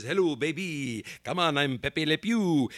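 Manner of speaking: mock French-accented cartoon skunk. Host mimicking a cartoon skunk wooing, French accent. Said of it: "had had the French accent and says".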